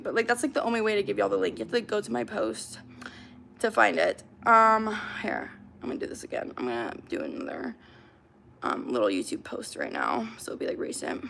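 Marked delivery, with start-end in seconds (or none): 5.2-11.2 muttering. Real, not acted. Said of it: "mutters while posting".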